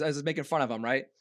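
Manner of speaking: ordinary talk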